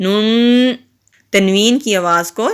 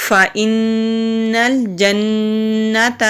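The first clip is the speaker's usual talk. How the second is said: slow, melodic Quranic recitation; much slower than usual. Shown as a demonstration. Teacher recites the joined phrase, slow melodic recitation. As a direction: intone it slowly and evenly.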